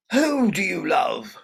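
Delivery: high, loud, slow demanding question; angry mother demanding to know who. Acted. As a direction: demand the name, seething.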